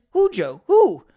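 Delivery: put-on insistent old lady. A narrator's reading. Old lady pressing Joe for a name, urgently. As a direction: demand it as an agitated old woman.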